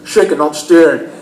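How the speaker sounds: slushy sh for every S; Sean Connery. Retold or imitated. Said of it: "He sounds more like Sean Connery".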